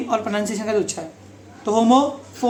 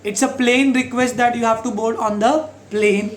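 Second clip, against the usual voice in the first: slow, plain and plane even; slow example sentence; much slower than usual. Shown as a demonstration. Teacher slowly modelling plain and plane. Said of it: "plane मतलब हवाई जहाज".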